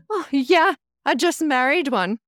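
cheerful Irish tour guide, lightly imitated; Irish accent. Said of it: "Well done on the accent".

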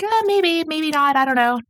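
high sing-song shrug, sliding gradually down; noncommittal. Retold or imitated. Podcaster mimicking a date dodging marriage questions, high and noncommittal. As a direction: imitate someone breezily dodging the question. Acting out her evasive answer.

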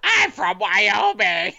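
slow, silly Wyoming put-on; hammed-up Wyoming voice; Wyoming accent. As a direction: stretch it out in a mock Wyoming accent. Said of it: "That's my good Wyoming accent".

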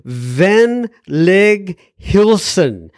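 "Venlig hilsen" sounded out very slowly, word by word; unsure foreign pronunciation. Real, not acted. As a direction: carefully attempt "Venlig hilsen" as unfamiliar foreign words. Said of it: "I can never get this right".

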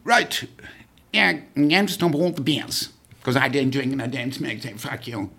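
a hint of the general laying down the law; Montgomery impression. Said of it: "Montgomery came and he said".

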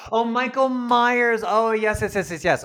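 loud, high "oh, of course" recognition; sudden realization. Real, not acted. Host had pictured Austin Powers, now exclaims in realization. Realizes which Michael Myers was meant.

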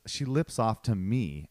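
ordinary talk, nothing marked

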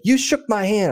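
high-pitched mock indignation; imitated protest. Texan protests at higher offer.

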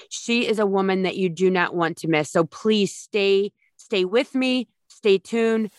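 ordinary talk

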